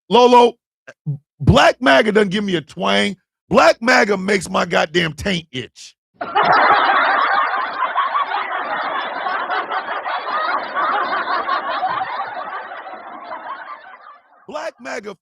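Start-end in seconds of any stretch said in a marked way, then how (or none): 1.4-6.0 louder, punchy comic outburst; loud joking rant. Acted. Ranting loudly to viewers for comedy. 6.2-14.1 squealing high-pitched laughter; long high laugh. Real, not acted. Laughing at his own crude joke, high and long.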